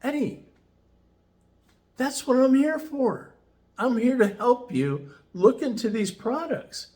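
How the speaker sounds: playing the helpful boss, lifted high and gentle; imitating Rick. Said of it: "and he goes".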